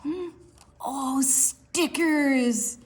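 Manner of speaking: high, slow, thrilled; delighted gasp. Acted. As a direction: savor the word stickers. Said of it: "Penguin pounced and clapped".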